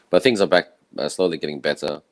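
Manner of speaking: ordinary delivery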